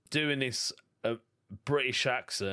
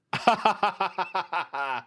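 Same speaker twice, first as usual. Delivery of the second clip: higher, rapid-fire belly laugh; staccato laugh; 6 semitones higher, 4 dB louder than usual. Real, not acted. Host amused by listener faking confusion over "sidewalk", staccato laugh. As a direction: burst out in a long rattle of ha-has. Amused by the client call dilemma.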